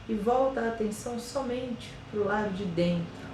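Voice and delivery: slow, soft meditation voice with long pauses; guided meditation. Acted. Yoga teacher guiding listeners through a body scan, slow and soft meditation voice. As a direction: ease them into calm, one body part at a time.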